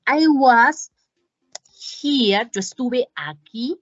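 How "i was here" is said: very slow, "I was" held apart and a little louder; teacher's slow, clear model sentence. Shown as a demonstration. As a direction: lay out each word of "I was here" slowly for learners. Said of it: "enfoquémonos en la escritura".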